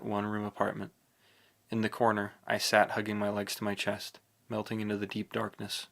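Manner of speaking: ordinary delivery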